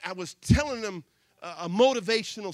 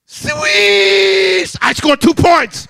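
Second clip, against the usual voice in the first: triumphant shout; 20 dB louder, much slower than usual. Retold or imitated. Boy celebrating his two points, triumphant shouting. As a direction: bellow the victory. Winning shot, joyful.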